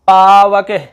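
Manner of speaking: पावक with a very long पा; sound-spotting demonstration. Shown as a demonstration. Teacher sounds out the example, drawn-out pronunciation. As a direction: stretch पावक slowly on its first vowel. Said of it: "आय की ध्वनि आ रही है".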